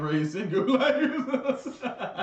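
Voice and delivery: can barely get it out for laughing. Real, not acted. Joke told while cracking up.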